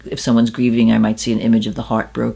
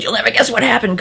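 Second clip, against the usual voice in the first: excited retelling; 11 semitones higher, faster than usual. Retold or imitated. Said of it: "I said".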